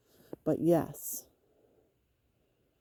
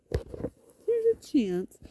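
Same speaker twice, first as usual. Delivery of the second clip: 1 high, slow teasing; 10 semitones higher, much slower than usual. Real, not acted.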